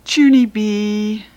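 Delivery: a mom who smells trouble; suspicious. Acted. Mother suspicious of Junie B over the dented can. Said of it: "very suspicious".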